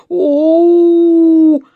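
long, high, loud "oooh"; answering howl. A narrator's reading. Bear answering the dentist's yell, long loud howl. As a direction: howl back excitedly. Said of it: "отозвался Паддингтон".

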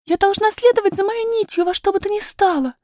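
put-on high girlish voice, resolute; piping, determined little princess; determined young princess. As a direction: declare it firmly in a high child's voice. Princess's figure in the story.

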